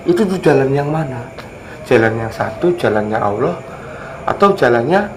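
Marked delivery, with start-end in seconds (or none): none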